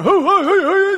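hammed-up pagan prophets calling out; prophets crying out. Pagan prophets begging their gods, frantic. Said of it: "They're, they're going".

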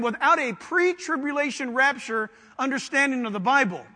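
usual speaking voice

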